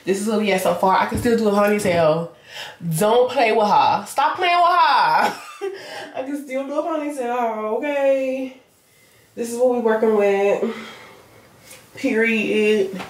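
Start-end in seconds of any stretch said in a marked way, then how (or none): 4.1-8.6 laughing, high. Real, not acted. Laughing at herself.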